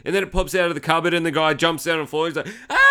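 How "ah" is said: high, loud mock scream; girlish scream. Retelling the guy falling from the cupboard, girlish scream. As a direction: shriek like a startled girl. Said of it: "scream like a girl".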